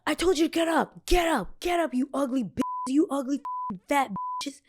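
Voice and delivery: high mocking yell at the girls; guard yelling insults. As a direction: imitate the guard screaming abuse. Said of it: "with the same energy".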